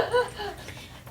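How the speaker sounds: high emotional laugh; a relieved, teary little laugh. Real, not acted. Teary resident laughs with host.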